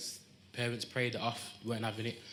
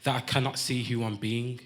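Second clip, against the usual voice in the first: punchy, rhythmic performance poetry, louder than chat; spoken-word poem; 7 dB louder than usual. Acted. Poet opens his piece to the crowd, spoken-word delivery. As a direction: land each phrase like a poetry slam.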